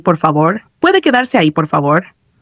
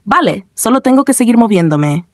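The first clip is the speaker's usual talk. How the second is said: usual speaking voice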